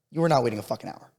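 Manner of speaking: normal talking voice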